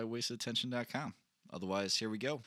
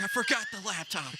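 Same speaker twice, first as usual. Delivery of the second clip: high, hurried confession; telling girlfriend why he's back home; retold panic; 9 semitones higher than usual. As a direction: blurt it out in a rush. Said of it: "I was like".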